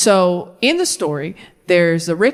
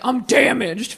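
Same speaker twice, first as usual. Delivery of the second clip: straight dude; slower than usual. Acted. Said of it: "If a straight dude's like".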